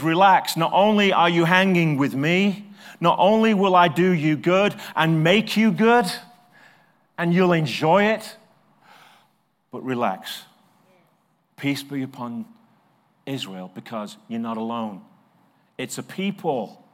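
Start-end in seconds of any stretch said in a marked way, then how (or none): 0.0-8.4 booming reassurance, louder; God's voice, loud. Retold or imitated. God telling his people to relax, loud and fervent. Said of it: "He says". 9.7-15.0 soft, quiet calming words; gentle reassurance. Retold or imitated. God calming his people, soft and reassuring. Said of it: "He says".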